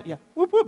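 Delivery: high mock cheer; whoop whoop. Real, not acted. Pastor echoing the crowd's cheers with a high whoop.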